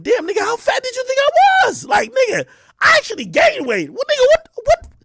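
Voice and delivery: high, loud exasperated inner voice; inner outrage. Retold or imitated. Thinking back at a friend, high indignant squeal. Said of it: "you're really thinking".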